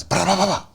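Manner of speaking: high rapid-fire shot noises; imitated gunfire. Storyteller mimicking five shots, rapid gunfire imitation. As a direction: rattle off gun sounds with the mouth.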